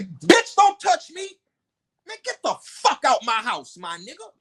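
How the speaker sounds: retold furious yell, swinging high; snapping at her. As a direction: bark her off, kick them out.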